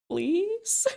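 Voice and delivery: very high, tiny pleading please?; squeaky high mock plea; cute begging. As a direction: beg sweetly for the job. Begging event organisers for a job.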